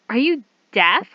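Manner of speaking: acted, punchy and incredulous; acted indignant question. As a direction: throw it out loudly, as a jab. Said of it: "Do you want me to guys to do the accent?".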